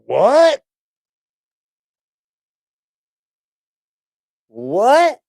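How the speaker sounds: high, rising disbelief; real surprise. Real, not acted. Streamer reacting to a clip's claim, incredulous. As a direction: react as if you can't believe it.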